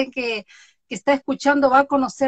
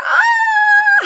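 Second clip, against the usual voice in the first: a sudden high mock shriek of panic; hammed-up scream of stage fright; mock panicked scream; 8 dB louder than usual. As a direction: shriek like the camera just scared you. Retelling fear of the camera.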